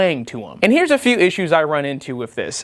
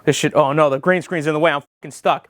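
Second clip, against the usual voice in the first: ordinary delivery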